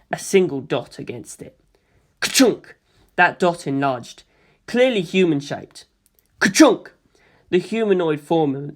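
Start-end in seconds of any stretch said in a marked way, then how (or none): 2.2-2.8 projector clunk. A narrator's reading. Projector sound effect. 6.4-6.9 punchy louder mechanical clunk; projector clunk. A narrator's reading. Projector sound effect.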